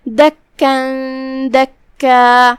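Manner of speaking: دکا recited very slowly, ending on a long alif; Quranic recitation demo. Shown as a demonstration. Teacher models the pause on دکا for students. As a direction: chant دکن دکا slowly, stretching the final alif. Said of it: "دکا پہ ہم رکیں گے تو مد الف سے رکیں گے".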